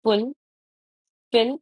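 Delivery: "फुल, फिल" as two separate beats with a long gap; syllable by syllable. Shown as a demonstration. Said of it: "कितने sound है इसमें?". ("फुल, फिल" अलग-अलग, रुक-रुककर)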